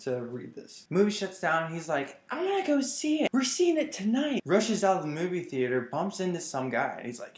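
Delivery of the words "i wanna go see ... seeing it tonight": put-on high-pitched bratty rich kid; spoiled brat. Little Bruce at the shut theater, bratty high-pitched demand. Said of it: "little Bruce Wayne is a spoiled brat".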